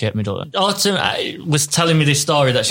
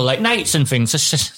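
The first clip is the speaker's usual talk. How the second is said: ordinary delivery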